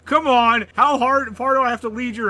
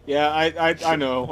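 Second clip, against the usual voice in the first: ordinary delivery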